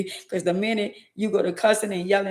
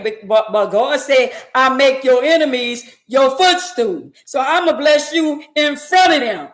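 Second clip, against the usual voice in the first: full-force preaching; 7 semitones higher, 8 dB louder than usual. Acted. Preacher proclaiming God's promise to the congregation, building to a shout. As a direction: rise into a triumphant proclamation.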